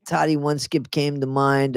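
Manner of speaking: ordinary talk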